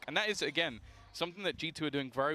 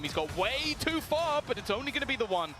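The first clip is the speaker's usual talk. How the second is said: excited; 8 semitones higher, 4 dB louder than usual. Real, not acted. Caster reacting to a rush.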